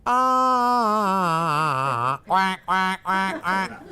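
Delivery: high held vowel breaking into duck quacks; throat-driven note, quacking. Shown as a demonstration. Teacher mocks throaty singing, note turning into quacks. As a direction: force it through the throat until it quacks. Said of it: "go straight through the throat".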